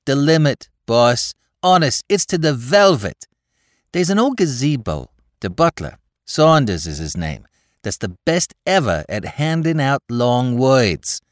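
put-on excited young New York tough; Bowery boy Spike. A narrator's reading. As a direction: rattle it off like a breathless Bowery lad. Spike voiced by narrator.

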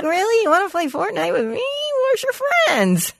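high, incredulous mock reply. Telling off Sonny, who asks to play Fortnite: high mock disbelief. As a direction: act amazed he wants to play with you. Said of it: "I was like".

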